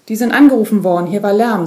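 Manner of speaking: the father cuts in loudly over the police; father, pushier. A narrator's reading. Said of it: "sagt er und fasst ihren Arm". (Vater, lauter und drängend)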